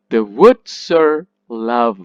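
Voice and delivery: WOODS and LOVE long and loud, "the" and "are" light; stress on the beats. Shown as a demonstration. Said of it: "Love is stressed".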